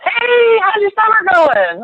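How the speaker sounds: very high, sing-out hello imitation; gushing greeting. Imitating a lovely woman opening a call, high and gushing.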